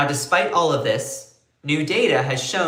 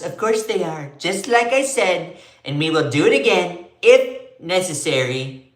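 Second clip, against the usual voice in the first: higher, louder boastful Trump; Trump impression; 4 semitones higher, 4 dB louder than usual. Host quotes Trump's interview reply in his voice. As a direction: swagger through it like Trump. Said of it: "I can do a good Trump".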